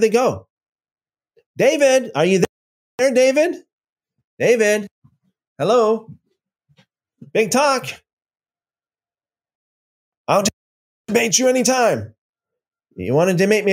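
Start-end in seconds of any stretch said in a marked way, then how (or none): 1.6-7.9 high, sing-song calling for him; mock calling out. Real, not acted. Streamer calling for a troll who left, mock searching. 10.3-12.1 high, taunting echo of his boast; mocking imitation. Streamer mocking the troll's boast, high and taunting.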